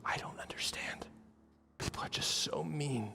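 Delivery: whispery, near-tears imitation; very soft, imitating someone holding back tears; tearful hurt. Flight attendant confiding in a kind passenger, tearful whisper. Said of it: "She has, like, tears in her eyes".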